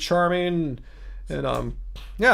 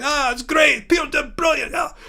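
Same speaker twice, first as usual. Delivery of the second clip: high, cheery put-on Scottish voice; playful mock-Scottish lilt; Scottish accent; 9 semitones higher than usual. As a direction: imitate chatting Scots, pitched up high. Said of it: "did you like my Scottish accent".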